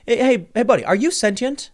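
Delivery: eager, high mock street hail; hailing a stranger. Retelling how he hailed a passerby.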